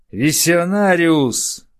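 high, loud, slow shout; yelling. Acted. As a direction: bellow the name in rage. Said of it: "вопил он". (гневный вопль)

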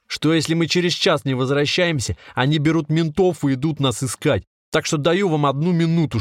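high-pitched, insistent threat; shouted in one breath. A narrator's reading. Trapped man threatens captors through window, shouting in one breath. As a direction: rattle out the ultimatum without stopping. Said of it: "Он прокричал всё это на одном дыхании".

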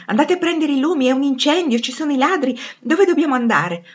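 loud, high cries of alarm; panicked crowd. A narrator's reading. (grida di panico e confusione)

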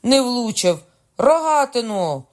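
high, loud cry, last word drawn out; frustrated high-pitched shout. A narrator's reading. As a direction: yell out the miss and demand a weapon. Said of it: "крикнув король".

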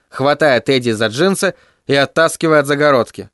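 plain reading voice

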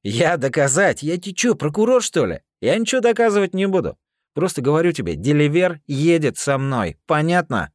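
loud, high mocking con; russian prison slang. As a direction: shout him down like a street thug. Hero's heated confrontation in slang.

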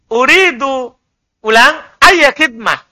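ordinary delivery

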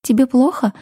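light, high, concerned question; worried chirp. A narrator's reading. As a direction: chirp it out with a touch of worry. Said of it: "обеспокоенно чирикнула Яся".

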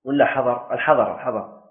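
normal talking voice

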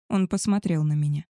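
ordinary delivery

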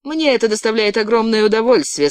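high-pitched gloating delight. Acted. Girl savouring the beating of her captive, gloating.

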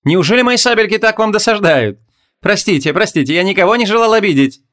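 high, fake-apologetic taunt; sneering sing-song sorcerer voice; mocking sorcerer. A narrator's reading. Sorcerer taunts, words contradict deeds.

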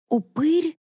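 sharp high disbelieving "Упырь?"; startled question. Acted. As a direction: blurt it out in shock. Startled question about ghoul.

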